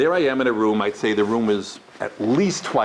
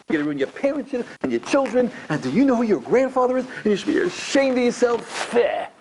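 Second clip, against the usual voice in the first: policeman-style scolding; 4 semitones higher than usual. Acted. Stern educator shaming a student over Shabbos, acted high-pitched scolding. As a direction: shame them in mounting mock outrage. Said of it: "Here's what a policeman does".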